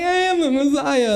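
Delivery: put-on high, insistent kid voice. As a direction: insist on it like a stubborn six-year-old. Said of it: "I'm like".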